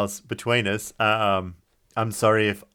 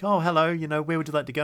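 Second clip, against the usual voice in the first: polite taxi driver; 7 semitones higher, faster than usual. Retold or imitated. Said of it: "and he's like".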